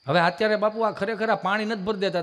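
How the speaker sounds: ordinary talk, nothing marked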